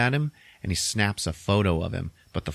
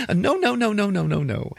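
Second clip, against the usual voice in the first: high, giggly string of denials; fake laughing; 12 semitones higher than usual. Maid denying it after tearing up, fake laughing. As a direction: imitate a forced laugh through the words. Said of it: "like, fake laughing".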